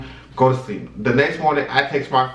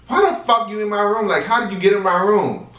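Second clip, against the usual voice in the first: retold yelling; 9 semitones higher than usual. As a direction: re-enact an outraged confrontation. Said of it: "like, yelling, cussing at him".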